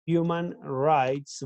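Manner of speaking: human rights said slowly, each word drawn out; slow careful vocabulary. Shown as a demonstration. Teacher introducing human rights to students, slow and careful. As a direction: spell out human rights for the class. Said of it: "much better".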